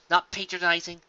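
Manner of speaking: ordinary delivery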